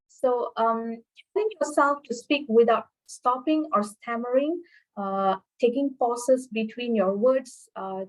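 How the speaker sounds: ordinary delivery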